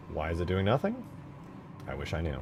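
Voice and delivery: normal talking voice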